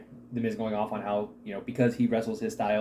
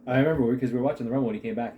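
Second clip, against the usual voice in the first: ordinary talk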